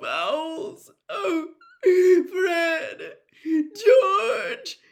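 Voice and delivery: high, loud sobbing lament. A narrator's reading. Mother to twins Fred and George, high loud sobbing. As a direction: weep over what might have been. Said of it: "starting to sob".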